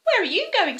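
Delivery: a bright, high little owl calling out. Acted. Owl speaks in the story.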